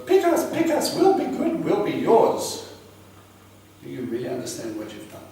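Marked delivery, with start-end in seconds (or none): 0.1-2.7 put-on much higher, louder childish begging; mock begging king. King begging Assyria for protection, high mock begging. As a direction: beg like an eager child. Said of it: "he goes".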